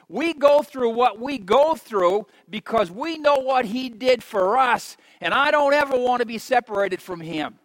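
ordinary delivery

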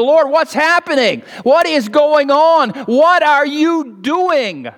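high, anguished plea, slowing to the end; a desperate widow begging heaven for answers; crying out to God. Acted. Widow in famine cries out to God, drawn-out anguished plea. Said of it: "she's crying out to God and saying".

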